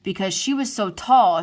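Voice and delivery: ordinary delivery, nothing marked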